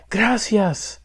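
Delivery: high-pitched, understated yell of a name; mild yell. A narrator's reading. Boy sees his lost turkey returned, mildly yelling. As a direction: call out high without much volume. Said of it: "yelled Miguel".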